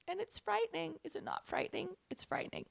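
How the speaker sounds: very soft, sliding into a whisper; hushed mock fright. Host sharing a frightening thought, hushed mock fright. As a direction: confide it like a scary secret.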